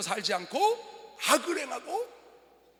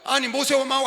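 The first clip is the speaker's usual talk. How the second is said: raised pulpit voice, climbing to a shout; forceful preaching; 6 semitones higher, 11 dB louder than usual. Acted. Pastor telling of Israel blaming Moses, forceful preaching. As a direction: build in indignation with each phrase.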